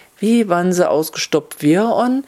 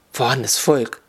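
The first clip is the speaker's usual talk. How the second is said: lower, hissed jab at the rabble; hissed rebuke; 4 semitones lower than usual. A narrator's reading. Court usher rebuking the animals, hissing. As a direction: hiss it low, with contempt. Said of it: "zischte Gerichtsdiener".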